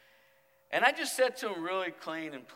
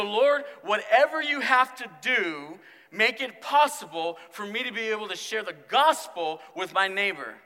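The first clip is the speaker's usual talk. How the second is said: loud, higher, confident prayer; bold prayer; 5 semitones higher, 8 dB louder than usual. Shown as a demonstration. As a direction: pray boldly like you mean every word. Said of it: "We need to go with boldness and say".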